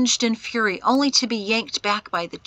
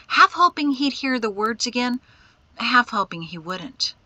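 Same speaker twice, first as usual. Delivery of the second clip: ordinary delivery, nothing marked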